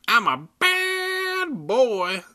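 high, slow baby-voiced dummy; shrill baby voice. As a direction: squeal it out like a bratty baby doll. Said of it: "the dummy said in a shrill baby voice".

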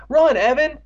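high, loud panicked yell; scream. A narrator's reading. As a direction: scream at a friend to flee. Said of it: "Andy screamed".

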